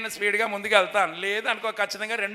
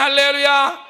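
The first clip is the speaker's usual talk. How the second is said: calls out a ringing hallelujah to the congregation; loud hallelujah call; 6 dB louder than usual. Real, not acted. Preacher urging the church to answer loud, rousing call. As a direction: rouse the crowd with a loud shout. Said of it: "దద్దరిల్లిపోయేలా చెప్పాలి".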